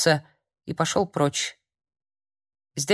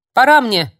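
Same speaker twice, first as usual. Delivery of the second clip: usual speaking voice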